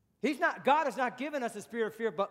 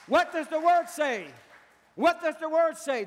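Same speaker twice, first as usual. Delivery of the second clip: loud, raised preacher's question; insistent challenge; 5 semitones higher, 5 dB louder than usual. Real, not acted. Preacher challenging listeners to check scripture, loud and insistent. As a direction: press the question hard on the listeners.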